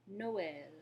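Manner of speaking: Noël, two separate vowels; Noël split into no-el; French Noël. Shown as a demonstration. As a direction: model Noël for repeating. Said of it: "to pronounce Noël".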